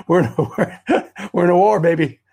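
chuckling rally cry; laughing. Real, not acted. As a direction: break into laughter mid-sentence. Laughing as he rallies the listeners.

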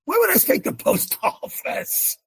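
high-pitched, baffled protest breaking into a laugh; incredulous. Retold or imitated. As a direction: squeak out your disbelief at a silly order. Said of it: "And I thought, "That's stupid".

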